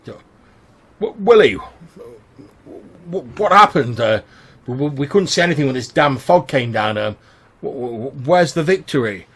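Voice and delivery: high, loud, stammering panic; panicked sailor. Acted. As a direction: stutter out the fear of a man who lost his ship.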